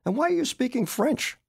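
high, challenging question; demanding. A narrator's reading. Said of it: "I demanded".

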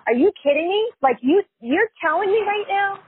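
incredulous, mildly angry retort; pissed off. Retold or imitated. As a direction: retell an outraged comeback, pitch jumping up. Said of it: "I was so pissed".

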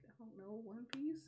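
soft, muted tearful answer; a touch of sad girl. Retold or imitated. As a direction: mumble it like a grieving girl. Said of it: "She's like".

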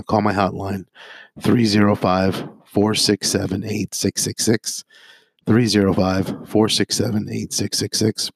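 normal talking voice

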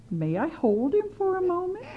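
put-on high, pleading old-lady voice; sweet granny request. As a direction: ask sweetly to hold the baby. Witch asking for baby.

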